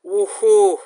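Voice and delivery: loud cheer; excited whoop. Real, not acted. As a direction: whoop for joy. Cheering at the screen.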